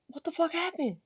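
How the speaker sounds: high, hushed disbelief; retold quiet shock. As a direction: imitate a stunned friend, voice soft and high. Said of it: "like, he was shocked".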